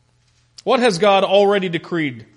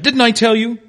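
exasperated; 6 semitones higher, 5 dB louder than usual. Acted. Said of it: "throwing up his hands a little bit".

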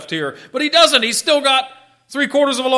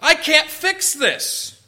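slow, high, earnest admission of need; a preacher voicing a humble sinner's plea; humble confession; 5 semitones higher, slower than usual. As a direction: confess it slowly and sincerely. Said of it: "we have to recognize".

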